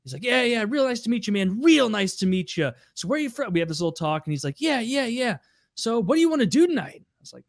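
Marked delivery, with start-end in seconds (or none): 0.2-1.6 higher, eager bro voice; imitating Andy. Andy's first meeting. 1.6-3.5 higher eager bro voice; imitating Andy. Andy's first meeting. 4.6-7.0 higher, eager party-bro voice; itching to go out; imitating Andy.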